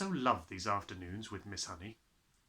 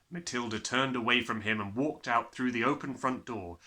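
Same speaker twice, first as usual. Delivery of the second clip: usual speaking voice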